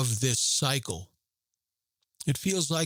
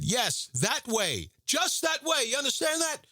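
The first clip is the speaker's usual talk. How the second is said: drill sergeant shout; 11 semitones higher than usual. Retold or imitated. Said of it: "He said".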